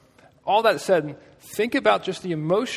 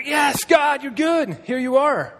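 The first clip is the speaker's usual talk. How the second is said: high, loud cry of praise; elated, full-voiced celebration; acted jubilant shout; 10 semitones higher, 5 dB louder, much slower than usual. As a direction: shout it up to God in triumph. Acting Paul's joyful cry to God.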